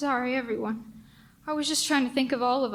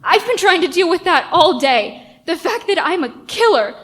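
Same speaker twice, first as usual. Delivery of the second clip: loud, higher, breaking with guilt; anguished confession; 7 semitones higher, 10 dB louder than usual. Acted. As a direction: blurt it out in anguish. Confession to police, overwhelmed.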